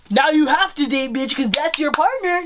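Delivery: teasing laughing bark. Acted. Joking bossy aside to viewers.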